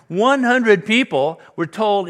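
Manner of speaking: slow, loud and high "One hundred people"; indignant emphasis. Real, not acted. Pastor decrying the Kenyan starvation cult, loud and slow. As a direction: hammer home the outrage. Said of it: "pastor indignant".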